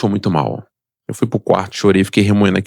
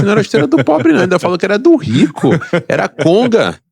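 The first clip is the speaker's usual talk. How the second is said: high, loud insistence; loud, high-pitched objection; excited protest; 11 semitones higher, 3 dB louder than usual. Real, not acted. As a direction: push back hard, voice climbing high. Heated denial about shoes.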